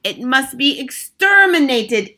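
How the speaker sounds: high, loud screeched command; Grand High Witch. A narrator's reading. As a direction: order the hunt as an imperious witch. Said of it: "screeched the Grand High Witch".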